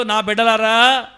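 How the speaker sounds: loud, slow divine promise; God promising strength, loud and slow. Acted. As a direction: proclaim as God to his children. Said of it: "దేవుడు చెప్తున్నాడు".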